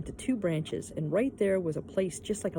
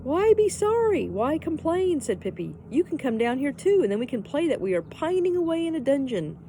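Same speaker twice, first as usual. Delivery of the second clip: cheerful Pippi; 8 semitones higher, 6 dB louder than usual. A narrator's reading. Pippi cheers crying Annika.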